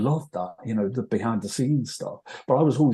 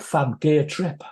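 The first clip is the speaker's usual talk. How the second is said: slow, higher Liverpool-style put-on voice; imitating Scouse slang; 5 semitones higher, slower than usual. [name] reporting Paul's verdict, in a mock Scouse drawl.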